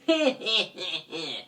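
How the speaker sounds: villainous giggling cackle; evil laugh. Acted. Bunny refuses hero's order, evil cackle. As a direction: cackle after defying the hero. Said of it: "His evil laugh once again".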